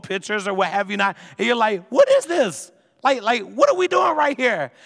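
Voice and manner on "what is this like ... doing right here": hammed-up teenage disbelief at old photos; shocked disbelief. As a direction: blurt it out in sudden shock. Teen finds old party photos.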